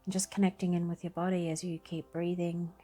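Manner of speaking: unhurried calm voice, long pauses between phrases; guided meditation. Acted. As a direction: guide them gently through breathing and body awareness. Leads a breathing meditation.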